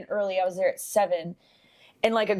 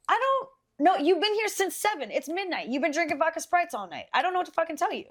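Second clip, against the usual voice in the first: exasperated retort; 7 semitones higher, faster than usual. Retold or imitated. Retold exasperated retort to customer.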